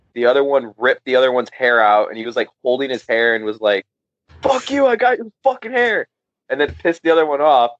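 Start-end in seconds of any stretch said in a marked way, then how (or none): 4.4-6.1 high-pitched retold gloat; mock triumphant taunt. Fighter holding his rival's ripped-out hair, mock triumphant taunt. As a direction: crow it like the winner of a scuffle.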